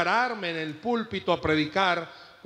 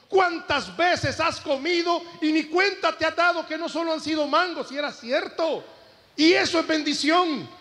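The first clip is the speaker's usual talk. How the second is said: much higher, driving sermon cadence; fervent preaching; 8 semitones higher than usual. Acted. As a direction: build the fervour, much higher, question after question.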